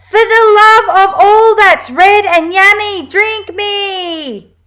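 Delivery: high, loud, desperate robot cry; desperate shouted plea. A narrator's reading. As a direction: beg at the top of your voice.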